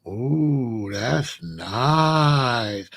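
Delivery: low, drawn-out admiring ooh; mama won over by the suit; impressed mother. Retold or imitated.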